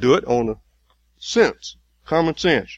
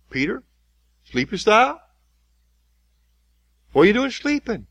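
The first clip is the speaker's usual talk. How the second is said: gentler rebuke; 9 semitones higher, 3 dB louder than usual. Acted. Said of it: "that's what he was saying to him".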